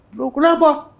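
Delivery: imitating the president's angry shout; mock angry outburst. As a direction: throw back an offended why.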